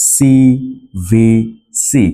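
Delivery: very slow, stretched CVC; CVC said very slowly, syllable by syllable; drawn out. Shown as a demonstration. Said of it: "যেটাকে আমরা সংক্ষেপে বলি".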